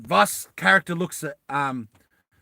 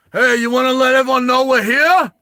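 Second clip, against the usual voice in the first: very loud, high yell across the distance; angry shout; 10 semitones higher, 13 dB louder than usual. Acted. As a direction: holler a full-force challenge at intruders. Stallone's character calls out angrily.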